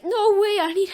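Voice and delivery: anguished cry before passing out; desperate cry. Acted. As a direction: push it out high and loud. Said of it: "Deku's like".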